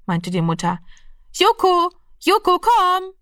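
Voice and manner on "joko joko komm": very high, singsong pet call; lockendes Rufen. A narrator's reading. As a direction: call the cat over in a high, held voice. Mother calls the cat. (lockend, hoch gerufen)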